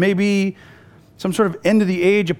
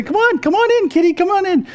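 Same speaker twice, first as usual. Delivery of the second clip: high sing-song come on in; calling the cat in; 11 semitones higher than usual. Retold or imitated. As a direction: call kitty in sweetly in a much higher voice.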